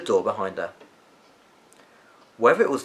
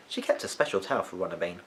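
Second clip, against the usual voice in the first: usual speaking voice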